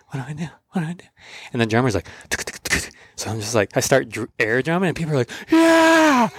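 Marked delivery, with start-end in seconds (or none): 0.1-1.0 softer, rising worried murmur; panicked murmur. Retold or imitated. Panicking under the spotlight. 2.3-2.9 whispered, softer beat count; whispered drum beat. Retold or imitated. Drummer signals the beat. 5.5-6.3 very high, louder cheer; crowd cheer. Retold or imitated.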